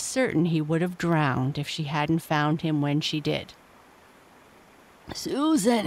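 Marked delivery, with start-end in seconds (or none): 5.1-5.9 choked gasp of a name; choking. A narrator's reading. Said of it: "he choked".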